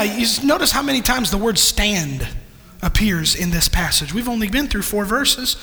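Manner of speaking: ordinary delivery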